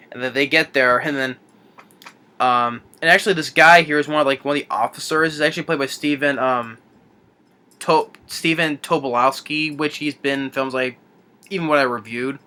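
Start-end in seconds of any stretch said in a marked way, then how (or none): none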